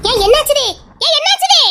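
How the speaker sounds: alarmed question. Acted. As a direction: ask loudly in alarm. Alarmed friend asks what happened.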